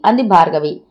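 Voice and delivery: ordinary delivery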